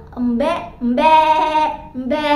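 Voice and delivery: bleating embe, the middle one loud; playful goat noise; goat bleat imitation. Shown as a demonstration. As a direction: mimic a goat for the kids. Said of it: "Suara kambing itu".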